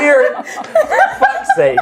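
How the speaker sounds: laughing. Real, not acted. Breaks up laughing.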